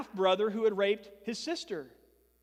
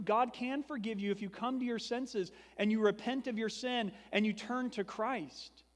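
ordinary talk

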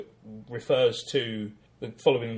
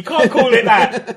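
laughing; 10 semitones higher, 13 dB louder, slower than usual. Real, not acted. Mocking award title.

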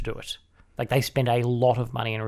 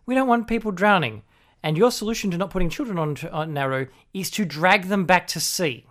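sarcastic mock quote; 7 semitones higher, 4 dB louder than usual. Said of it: "the justification for Nauru is".

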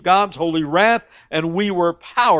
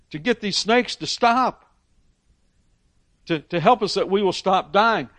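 higher, louder pleading to Moses; pleading people; 5 semitones higher, 3 dB louder than usual. Retold or imitated. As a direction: beg Moses as the frightened crowd. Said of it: "then they're going to Moses and going".

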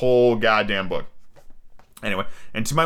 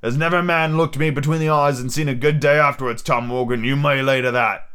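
higher-pitched threatening pirate captain; Long John Silver, menacing; 4 semitones higher than usual. Acted. Silver threatens Morgan for challenging him, menacing pirate role. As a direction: lay down the law as an old sea rogue.

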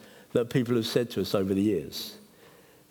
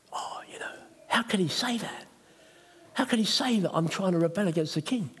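breathy gossiping whisper; mock whisper; 6 semitones higher than usual. Enemies gossiping about Nehemiah, mock whisper. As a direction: hiss it like a rumour.

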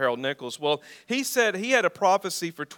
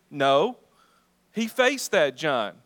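firm, slow punch on No then faced; emphatic answer; 4 dB louder, slower than usual. Acted. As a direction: drive the answer home. Preacher answering his own question.

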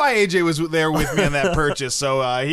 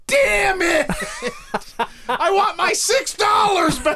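frustrated shout; 11 semitones higher, slower than usual. Retold or imitated. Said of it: "I was just like".

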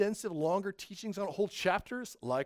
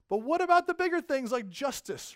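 Jesus rebuking; 10 semitones higher, 7 dB louder than usual. Retold or imitated. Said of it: "He goes".

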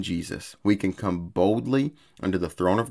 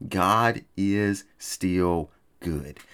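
very slow declaration; much slower than usual. Real, not acted.

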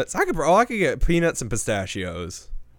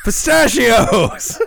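loud, drawn-out laughing blurt; laughing shout; 4 semitones higher, 7 dB louder, much slower than usual. Real, not acted. Finally remembers the nut name.

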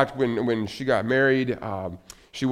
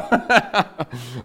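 stunned laugh; 4 semitones higher, 5 dB louder than usual. Real, not acted. Friends laugh at his confession.

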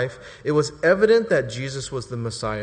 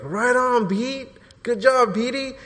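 high, pepped-up praise; cheering Jesus; 9 semitones higher, 4 dB louder, slower than usual. Retold or imitated. Jesus praising Peter's confession, high cheering. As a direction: cheer him on like a proud coach. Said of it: "Jesus will be like".

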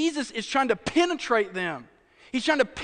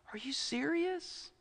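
soft, slow, higher-pitched disbelief; hushed incredulity; 4 semitones higher, 11 dB quieter, slower than usual. Acted. Preacher reacting to the brother's anger, hushed disbelief. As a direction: ask it slowly, almost under your breath.

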